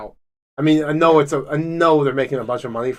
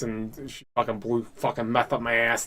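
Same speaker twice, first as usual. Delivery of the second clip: normal talking voice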